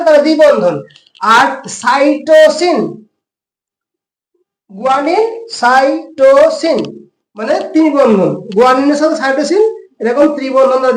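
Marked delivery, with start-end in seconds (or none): 1.3-2.8 cytosine said very slowly, syllable by syllable; syllable counting. Shown as a demonstration. Teacher counting syllables of cytosine for students, slow and spelled out. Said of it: "দুটো দুটো syllable". 5.6-6.8 cytosine said very slowly, syllable by syllable; syllable counting. Shown as a demonstration. Teacher counting syllables of cytosine for students, slow and spelled out. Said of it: "দুটো দুটো syllable".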